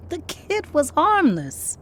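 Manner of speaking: high, loud, drawn-out objection; insist, stretching the words out; exasperated protest. Acted. Ella defending the kid to Lorraine, exasperated protest.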